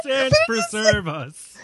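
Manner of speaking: slow, high, hammed-up Irish brogue. Irish cop exclaiming in the street, thick Irish accent. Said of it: "stereotypical Irish accent".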